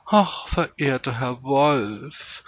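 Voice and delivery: high, slow, sugary flattery; gushing courtier, loud and high; fluting fox. A narrator's reading. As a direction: flute it sweetly, drawing out every word. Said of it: "flötete der Fuchs".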